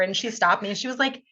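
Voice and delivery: normal talking voice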